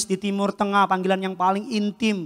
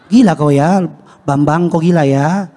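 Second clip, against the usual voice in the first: incredulous father; 7 dB louder than usual. Acted. Acts father's reaction to formal child.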